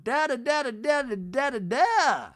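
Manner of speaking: playful high babble; mock chatter. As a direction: mimic chatting with mom, high. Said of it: "calling up my mom and going".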